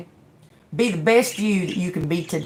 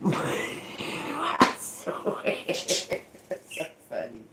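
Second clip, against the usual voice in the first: helpless, voiceless wheezing laughter; real laughing fit; 10 dB quieter, much slower than usual. Real, not acted. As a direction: laugh till the words barely come out. Cracking up at own joke.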